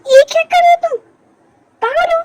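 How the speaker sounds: high-pitched, indignant protest; startled scolding. Acted. Woman scolding a friend for a sudden silly act, startled. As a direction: react in shock and tell them off.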